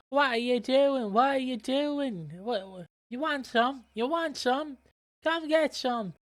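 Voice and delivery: put-on high-pitched confrontational Dublin accent; badly faked Dublin accent, challenging someone to a fight; attempted Dublin accent. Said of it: "I'm trying to do Dublin".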